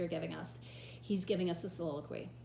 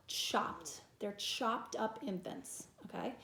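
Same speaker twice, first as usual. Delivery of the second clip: ordinary talk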